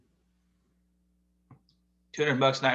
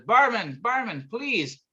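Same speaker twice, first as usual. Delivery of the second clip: frantic customer; 9 semitones higher, slower than usual. Retold or imitated. Frantic O'Malley at bar.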